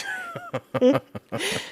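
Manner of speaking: high-pitched amused giggle; quick squeaky laugh. Real, not acted. Amused at the meme.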